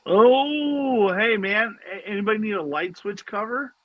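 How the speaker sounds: a lightly voiced proud buddy; Ben. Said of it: "Ben has to show the hero of the night".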